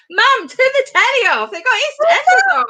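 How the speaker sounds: high-pitched mock Cockney; London accent. Listener mistaking it for EastEnders calls Mum, mock London accent. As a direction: call out in a hammed-up high London voice. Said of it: "gonna do a London accent".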